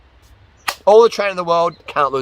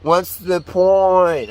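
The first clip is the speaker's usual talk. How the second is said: slow, drawn-out mock whine, higher; whiny, unmotivated; 4 semitones higher, much slower than usual. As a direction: drag it out like someone who can't be bothered. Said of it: "whiny and unmotivated".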